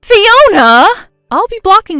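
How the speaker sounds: high, loud shout of her name; furious loud call; angry yell. Acted. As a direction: scream after her. Jen yelling at Fiona.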